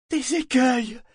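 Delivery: high, loud alarm cry; yelled warning. A narrator's reading. Liveship warning of reefs, yelling. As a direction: scream a lookout's warning. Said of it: "hurla Vivacia".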